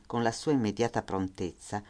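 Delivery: ordinary delivery, nothing marked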